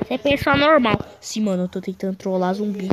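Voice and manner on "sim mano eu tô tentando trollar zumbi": a lightly voiced other player; low character voice. Voicing other characters lower.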